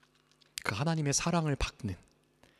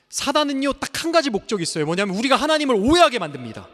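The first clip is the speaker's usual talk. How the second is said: fast, higher, louder warning; forceful preaching; 10 semitones higher, 9 dB louder than usual. Acted. Pastor warning the congregation about Satan, forceful. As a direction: drive the point home hard.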